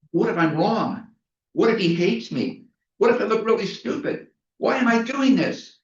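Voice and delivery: a nervous first-timer spiralling into self-doubt; panicky inner voice. Retold or imitated. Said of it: "I had this dialogue going on in my head".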